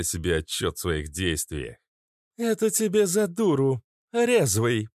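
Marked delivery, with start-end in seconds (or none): 2.4-4.9 high-pitched parting shout; calling after them. A narrator's reading. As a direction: call it out high to someone walking away. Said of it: "крикнула нимфа им вдогонку". (крикнуть вдогонку высоким голосом)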